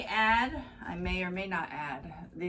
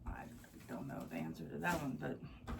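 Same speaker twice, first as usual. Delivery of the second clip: voice giving out; 6 semitones lower, 11 dB quieter, faster than usual. Real, not acted. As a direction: voice dropping to a whisper. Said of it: "I think I'm losing my voice".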